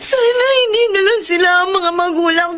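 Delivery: high, crying voice full of hurt; tearful. Acted. Daughter crying to her cousin about her family, tearful.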